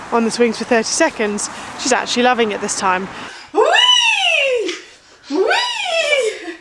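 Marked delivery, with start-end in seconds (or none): 3.5-6.6 high laughing whee; playful whee. Real, not acted. Mother pushing her toddler on the swings.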